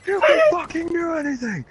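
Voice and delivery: high, strained motivational shouting; impassioned pep talk. Retold or imitated. Rousing lovesick friend Joe to action, high impassioned shout. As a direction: re-enact a rousing drunken speech at full yell.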